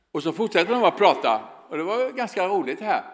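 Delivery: ordinary delivery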